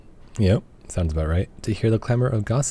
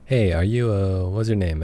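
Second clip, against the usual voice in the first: ordinary talk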